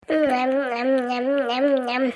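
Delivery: low, rhythmic "yum-yum" chewing noises; munch noisily on a mouthful; exaggerated munching. Eating the biryani just served, exaggerated munching.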